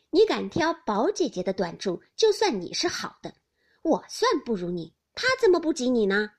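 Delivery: loud, spirited dare; combative, teasing challenge; challenging. A narrator's reading. Xiangyun challenges Daiyu.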